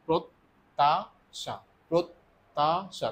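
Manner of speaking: প্রত্যাশা said very slowly, with the ত doubled and held; slow sounding out. Shown as a demonstration. Teacher showing students the double t, very slow. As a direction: stretch প্রত্যাশা out so both ত sounds come through. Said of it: "তটা মূলত দুইবার উচ্চারিত হচ্ছে".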